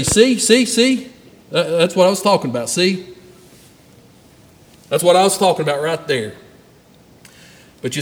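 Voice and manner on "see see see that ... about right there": mocking told-you-so. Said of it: "the world will look at that and say".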